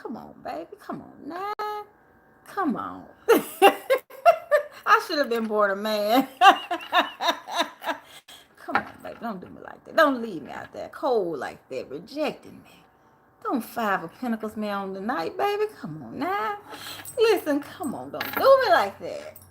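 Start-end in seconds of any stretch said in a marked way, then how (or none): 0.0-3.0 soft begging pimp voice; pimp pleading. Pretend. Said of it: "I'll be talking like a pimp". 8.6-19.4 pimp pleading. Pretend. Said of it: "I'll be talking like a pimp".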